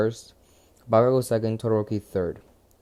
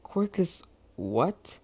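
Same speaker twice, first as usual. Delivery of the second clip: slow menacing taunt; 6 semitones higher, much slower than usual. Retold or imitated. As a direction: stretch it out to dare him.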